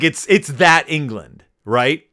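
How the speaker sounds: normal talking voice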